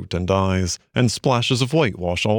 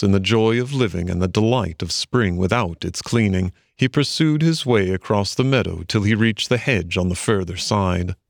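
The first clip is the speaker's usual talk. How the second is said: usual speaking voice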